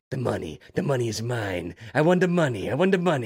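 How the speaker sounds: a hint of a greedy old man, breaking into a laugh; lightly voiced money-hungry old man; Sid Caesar impression. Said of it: "I always think of him as in Vegas Vacation".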